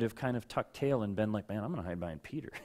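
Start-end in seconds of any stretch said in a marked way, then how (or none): 1.5-2.5 quick sheepish aside. Retold or imitated. Imagined cowardly thought.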